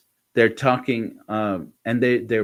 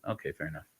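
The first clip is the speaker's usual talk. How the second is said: much softer, lower; muttered concession; 6 semitones lower, 15 dB quieter, much faster than usual. Retold or imitated. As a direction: concede under your breath. Conceding the point.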